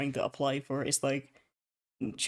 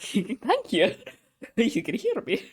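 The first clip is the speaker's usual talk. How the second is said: thanks broken by laughter, pitched up; giggling sarcastic gratitude; laughing relief; 7 semitones higher than usual. Retold or imitated. Relief when the operator finally responds.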